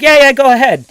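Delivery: retold chirpy permission; eager go-ahead. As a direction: voice the others waving you on. Retold reply from the others.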